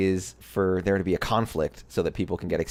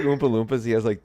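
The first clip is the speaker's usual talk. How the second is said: usual speaking voice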